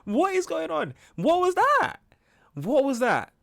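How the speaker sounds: high, incredulous yelps of confusion; stunned disbelief. Real, not acted. Reacting to a weird dating show, high stunned disbelief. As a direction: squeal it out, totally baffled by the clip.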